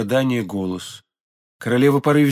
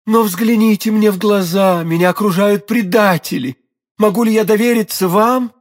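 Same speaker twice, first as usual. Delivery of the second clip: raised, louder, urgent pleading; agitated cry; 6 semitones higher, 4 dB louder than usual. A narrator's reading. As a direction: implore loudly, surrounded and distrustful. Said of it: "вскричала королева".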